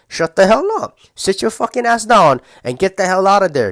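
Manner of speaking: loud, high scolding of the tempted self; mock scolding. Telling off his own Big Mac craving, loud and angry.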